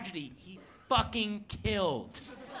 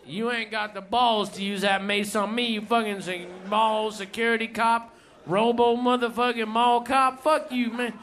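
higher, rowdy storytelling rant; ranting stand-up bit; 3 semitones higher than usual. Retold or imitated. Comedian performs the friend's set, rowdy and higher. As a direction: tear into it like an angry comic.

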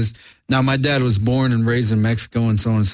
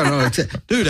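ordinary talk, nothing marked

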